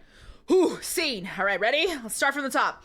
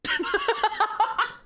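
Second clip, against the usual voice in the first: laughing; 9 semitones higher, 4 dB louder, slower than usual. Real, not acted. Laughing at donation surprise.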